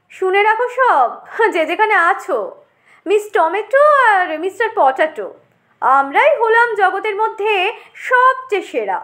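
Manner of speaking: boastful potato. Acted. Potato tells all that they are the world's best, pompous. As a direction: announce your supremacy to everyone around.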